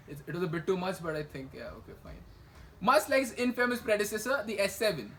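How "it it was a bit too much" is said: usual speaking voice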